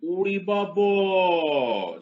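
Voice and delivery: long, high, drawn-out exclamation; hammed-up wail of astonishment; mock amazement. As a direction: stretch it out in theatrical awe. Mock awe at moment of truth.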